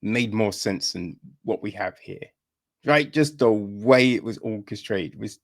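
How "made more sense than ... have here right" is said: ordinary talk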